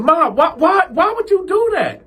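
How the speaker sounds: very high outraged; exasperated. Retold or imitated. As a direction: cry out in disbelief. Cal exasperated with mom.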